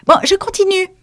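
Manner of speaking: high pompous lecturing mouse; resume the lesson grandly. Acted. The mouse resumes dry history, high and pompous.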